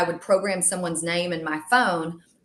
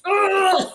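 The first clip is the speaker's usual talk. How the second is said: frustrated groan; 13 semitones higher, 6 dB louder than usual. Retold or imitated. Friend voicing frustration.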